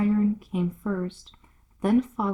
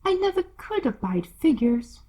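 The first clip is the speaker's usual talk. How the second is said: rude Duchess; 9 semitones higher, 4 dB louder than usual. Acted. Duchess scoffing at Alice, high and loud. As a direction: sneer it down at her.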